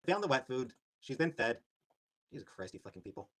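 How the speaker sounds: ordinary talk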